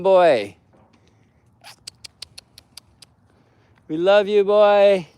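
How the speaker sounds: high, loud call across distance. Real, not acted. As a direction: call out to a lost dog. Calling out to lost dog.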